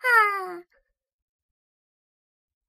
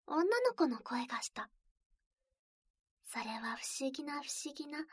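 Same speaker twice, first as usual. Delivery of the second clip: soft unvoiced storytelling whisper; whispered narration; 3 dB quieter than usual. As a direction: whisper it close to the ear. ASMR whispered scene opening.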